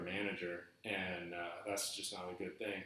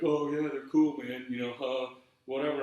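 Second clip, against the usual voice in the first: louder, higher imitation of a brush-off; mock dismissive; 4 semitones higher, 7 dB louder than usual. Manager brushing off a complaint about borrowed shoes, mock dismissive. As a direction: play an offhand manager, voice pushed up.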